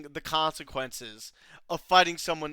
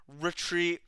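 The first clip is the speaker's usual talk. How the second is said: ordinary delivery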